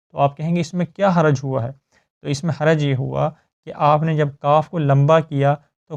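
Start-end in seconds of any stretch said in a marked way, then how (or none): none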